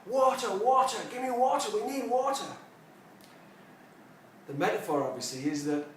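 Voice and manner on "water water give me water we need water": high, louder mock cry for water; mock shouting. Mystic voices thirsty fish for his class, mock shouting. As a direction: call out urgently as the shouting fish. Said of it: "The fish are shouting".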